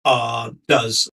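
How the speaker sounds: audio cutting in and out; choppy, dropping connection; corrupted glitchy audio. Real, not acted. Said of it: "your audio's cutting out".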